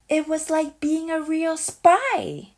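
plain reading voice

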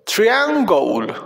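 "triangle" stretched syllable by syllable; slow "triangle" right down for learners; drawn-out pronunciation model. Shown as a demonstration. Teacher showing children how English "triangle" sounds, slowly drawn out. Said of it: "Así se pronuncia triángulo en inglés".

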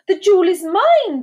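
high, loud claim; possessive declaration. A narrator's reading. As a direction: claim it for yourself, emphatically. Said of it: "they each declared".

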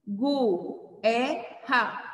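"gu, erra" split apart with the U sounded; separado errado. Shown as a demonstration. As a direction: say "gu", pause, then "erra". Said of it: "Se a gente for separar". ("gu, erra" separado, pronunciando o U)

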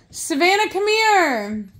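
calling her daughter to let the dog out; urgent calling. Real, not acted. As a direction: call her over in a hurry, high.

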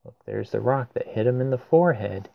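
usual speaking voice

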